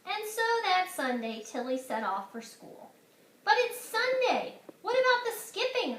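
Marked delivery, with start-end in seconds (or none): 3.4-6.0 put-on much higher, louder little-boy protest; small brother protesting; brother calling. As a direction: call after her, dismayed. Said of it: "her brother called".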